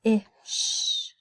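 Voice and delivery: "fish" and "Ish" whispered to isolate the vowel; hushed phonics demo of "Ish"; whispered sounding out. Shown as a demonstration. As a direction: whisper the word, then just its vowel. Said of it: "Do you hear the I sound?".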